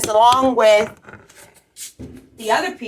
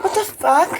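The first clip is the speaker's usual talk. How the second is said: high, thrown-up disbelief; can't believe what you're hearing; incredulous; 8 semitones higher than usual. Retold or imitated. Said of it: "So I was like".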